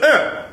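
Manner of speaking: put-on high, whiny toddler grunt of protest; imitating a toddler's high protest grunt. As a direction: grunt like a one-year-old refusing. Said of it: "he goes".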